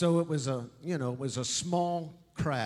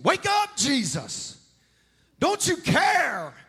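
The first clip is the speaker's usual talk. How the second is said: much higher, loud panicked shout; frantic disciples; 11 semitones higher, 7 dB louder, slower than usual. Acted. Disciples shouting at sleeping Jesus, frantic. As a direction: yell in a hammed-up panic. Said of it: "that was kind of the scene".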